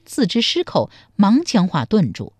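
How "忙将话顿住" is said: ordinary delivery, nothing marked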